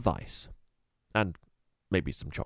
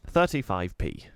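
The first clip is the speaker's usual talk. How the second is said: usual speaking voice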